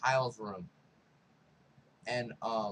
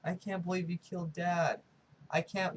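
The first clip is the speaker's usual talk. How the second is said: higher-pitched, lightly acted distress; mock tearful; 4 semitones higher than usual. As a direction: imitate a shaken son on the verge of tears. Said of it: "he starts pouring in tears".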